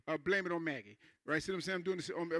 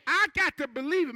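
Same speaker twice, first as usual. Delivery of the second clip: high, loud declaration; preaching cry; 7 semitones higher, 7 dB louder than usual. Real, not acted. Preacher urging the congregation to believe, loud and high. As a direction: proclaim it with full force.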